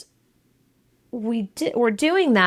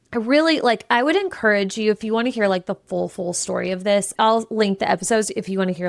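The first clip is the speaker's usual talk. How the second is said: ordinary talk, nothing marked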